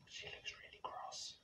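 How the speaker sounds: hushed unvoiced aside; whispered aside. Real, not acted. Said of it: "aside to audience".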